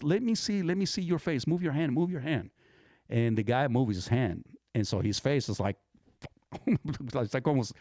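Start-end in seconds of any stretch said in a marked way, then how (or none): 0.0-2.5 high-pitched, insistent nurse's orders; imitated insistent nurse. Nurse to injured kid holding his face, imitated insistence. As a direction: play the nurse taking charge, voice raised in pitch. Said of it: "and then she goes".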